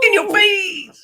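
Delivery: high, drawn-out taunt; mock-triumphant high jeer; playful gloating. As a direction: crow it slowly at the beaten rivals. Gloating over Iowa's defeat.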